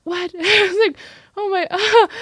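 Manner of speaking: high, loud disbelief broken by laughs; laughing amazement. Retold or imitated. Educator recalling her reaction to student's thanks, laughing in amazement. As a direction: replay being floored, laughing through it.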